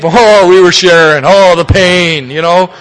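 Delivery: laughing, hammed-up woeful "Oh, we were sharing", drawn out slow; mock self-pity. Old-timer mocking the self-pitying shares of dump meetings, hammed-up woe. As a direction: send up the old wallowing with a laugh.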